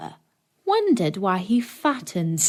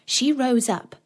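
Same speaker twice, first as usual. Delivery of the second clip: usual speaking voice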